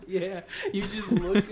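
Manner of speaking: usual speaking voice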